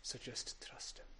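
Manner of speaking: breathy whisper, barely voiced; whispered plea. Real, not acted. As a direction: hush it out as a gentle plea. Gentle final appeal.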